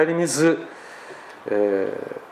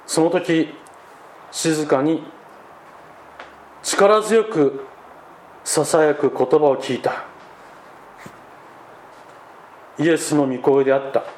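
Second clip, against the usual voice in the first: solemn dramatic reading; 4 semitones higher than usual. Acted. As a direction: read it like a mournful testimony. Reads memoir text aloud. (厳粛な朗読)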